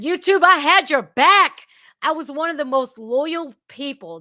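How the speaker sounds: high, loud protest; indignant outburst. Real, not acted. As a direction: call YouTube out, raising your voice. Reproaches YouTube for betraying loyal defender.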